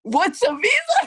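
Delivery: high, loud mimicked disbelief; mock incredulous boss. Boss mimicked reacting to her ignorance.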